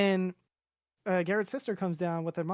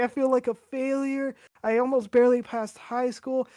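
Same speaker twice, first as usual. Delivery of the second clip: high, louder mock wail, without real sobs; retold bawling; 6 semitones higher, 5 dB louder than usual. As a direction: re-enact a tearful outburst, pitched up. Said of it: "I start bawling my ass".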